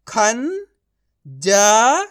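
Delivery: खंजर drawn out very slowly, sound by sound; slow syllable demo. Shown as a demonstration. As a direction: stretch खंजर out slowly so each part is heard. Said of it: "तो मिलके बन जाएगा". (खंजर को बहुत धीरे खींचकर, अक्षर-अक्षर बोलें)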